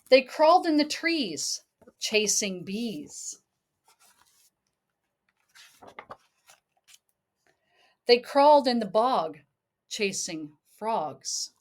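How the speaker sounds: lilting read-aloud storybook voice; sing-song picture book reading; reading to a child. Acted.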